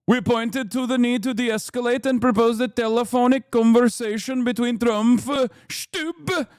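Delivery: put-on Norwegian accent with deliberate, lilting delivery. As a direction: read the statement in an exaggerated Norwegian accent. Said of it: "that's a perfect Norwegian accent".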